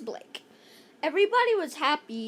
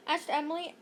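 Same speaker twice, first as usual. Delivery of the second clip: usual speaking voice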